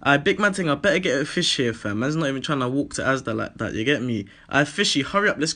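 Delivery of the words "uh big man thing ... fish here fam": normal talking voice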